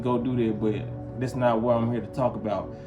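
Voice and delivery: ordinary talk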